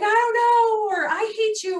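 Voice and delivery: put-on a high, upset child lashing out. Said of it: "And they're like".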